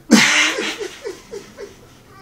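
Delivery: high, loud burst of laughter. Real, not acted. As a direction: crack up in a high voice. Laughs amid acted exchange.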